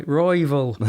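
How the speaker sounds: "Royval" said like "Raival" in an Irish accent; Irish-accented "Royval", vowel like "rival". Shown as a demonstration. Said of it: "sounds like you're saying rival in the, in Scotland".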